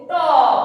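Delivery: टॉप called out loudly, open O; loud teacher model of टॉप; model word. Shown as a demonstration. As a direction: say टॉप loud and clear for pupils to copy. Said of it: "बोल के देखिए उसको".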